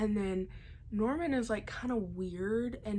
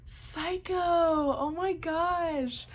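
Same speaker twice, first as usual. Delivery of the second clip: high, loud, drawn-out cry of disbelief; shocked exclamation; 9 semitones higher, 7 dB louder, much slower than usual. Real, not acted. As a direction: exclaim it way up high, stretching the words. Reacts to the shocking twist.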